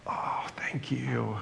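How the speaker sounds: breathy grateful whisper, slow; whispered thanks. Pretend. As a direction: breathe the thanks out softly and slowly. Grateful sigh of surrender.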